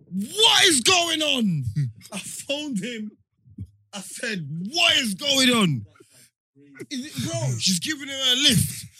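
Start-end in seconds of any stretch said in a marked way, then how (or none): 0.1-1.6 loud, slow, higher disbelieving cry; shocked shout. Retold or imitated. Retold outburst on seeing car. 4.7-5.8 demanding shout. Retold or imitated. Retold demand on the phone.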